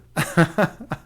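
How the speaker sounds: short real laugh. Real, not acted. Streamer amused by own sleep-for-money joke, short laugh. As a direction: chuckle at your own joke.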